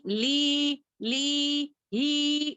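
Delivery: ली and ही stretched out on a held note; wrongly dragged vowels. Shown as a demonstration. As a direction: drag the i in ली, ही as a mistake. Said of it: "इस तरीके से नहीं खींचेंगे".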